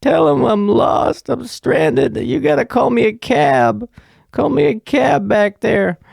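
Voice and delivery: put-on very high-pitched drunken pleading; Sheila. Stranded guest begging the clerk for a cab, high and drunk. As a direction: stretch the words out in a high, distressed whine. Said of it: "I'm literally Sheila when I'm drunk".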